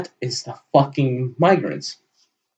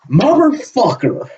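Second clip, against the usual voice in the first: a sharp, higher angry curse; angry outburst; 9 semitones higher than usual. Real, not acted. Ranting vlogger curses the man he's quoting, angrily. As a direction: spit the word out in real anger. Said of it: "Even I'm getting fucking pissed off".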